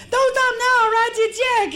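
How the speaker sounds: very high, loud woman's voice. Retold or imitated. Waitress begs the robber not to stop, very high and loud. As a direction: squeal it high and eager.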